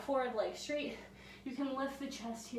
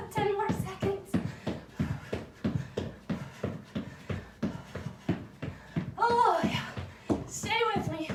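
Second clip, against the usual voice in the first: high, loud, pushing the class on; energetic coaching shout; 9 semitones higher, 5 dB louder than usual. Real, not acted. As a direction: cheer them through the last reps. Instructor pushing class through cardio.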